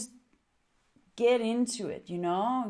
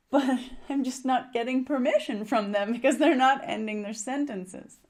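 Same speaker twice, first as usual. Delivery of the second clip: amused, high and loud through a laugh; 5 semitones higher, 5 dB louder than usual. Real, not acted. Coach telling how clients never let her respond, laughing. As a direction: laugh while voicing exasperation.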